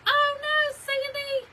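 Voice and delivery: high, loud dismayed cry; sympathetic oh no. Real, not acted. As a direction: gasp out your sympathy for a friend. Reacting to sad viewer comment.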